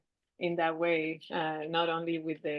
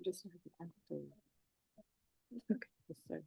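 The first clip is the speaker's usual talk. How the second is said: turning away from phone; 15 dB quieter, faster than usual. Real, not acted. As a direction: voice drops away from the mic. Steps away from the call.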